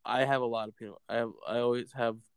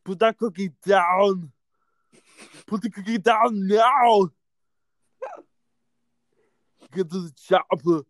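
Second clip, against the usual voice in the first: put-on louder, higher barking Austrian accent; Arnold impression; 5 semitones higher, 8 dB louder than usual. Host joins the game, shouting lines in an Austrian accent. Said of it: "It's Arnold Schwarzenegger".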